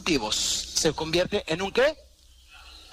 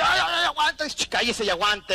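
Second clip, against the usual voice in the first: high, louder pained ay ay ay, then a slow hushing; complaining stomach; 9 semitones higher, 4 dB louder than usual. Retold or imitated. The overfed stomach protesting to its owner, mock pained. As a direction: voice a suffering stomach, then shush it. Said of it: "el estómago dice".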